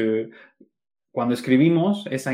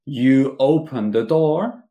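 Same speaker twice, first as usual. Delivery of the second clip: slow, louder full sentence; slow full form; 2 semitones higher, 5 dB louder, much slower than usual. Shown as a demonstration. Teacher contrasting full sentence, said slowly. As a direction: stretch out you open the door. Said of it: "En vez de decir".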